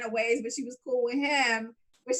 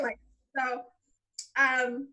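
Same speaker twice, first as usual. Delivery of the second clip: ordinary talk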